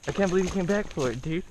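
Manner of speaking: fast, loud, high excitement; excited. Real, not acted. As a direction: blurt it out thrilled. The fish strikes again.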